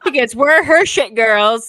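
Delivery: slow words through laughter; laughing. Real, not acted. Sisters cracking up over the nickname. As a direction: laugh it out slowly.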